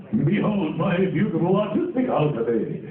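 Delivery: booming, theatrical hero voice with a laugh; Armstrong voice. Retold or imitated. Imitating colleague's famous Armstrong line, booming. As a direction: proclaim it like a grand showman. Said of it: "he plays, uh, Armstrong in Full Metal".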